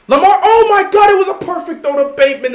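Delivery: loud, high shout, easing off as the play ends; real excited outburst. Real, not acted. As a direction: erupt at the big throw, pitch leaping up. Calls deep pass as it happens.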